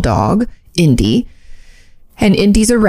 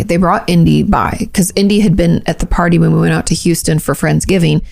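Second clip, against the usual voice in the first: ordinary delivery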